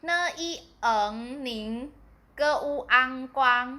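teacher's spell-out of เกออูอังกวาง, sounds first and whole syllable last. Shown as a demonstration. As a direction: sound out เนอีเอิงนิง and เกออูอังกวาง piece by piece. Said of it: "来拼一下".

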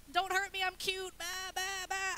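high pleading sheep voice; hammed-up cute lamb begging, then bleating; helpless little lamb. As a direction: plead like a defenseless cartoon lamb.

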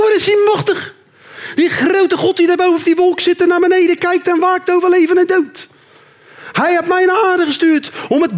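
hammed-up terrorist sermon; fanatic terrorist. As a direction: rant like a zealot on a mission. Terrorist rant in character.

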